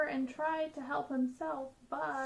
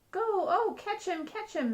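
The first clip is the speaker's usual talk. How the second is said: higher and loud on go and oh, urgent catch him; excited cries; 8 semitones higher, 5 dB louder than usual. Acted. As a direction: firemen grabbing at a runaway monkey. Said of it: "they cried".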